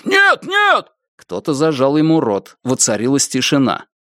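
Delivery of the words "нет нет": furious yell. A narrator's reading. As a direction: scream it in rage. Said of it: "в ярости заорал Феннер".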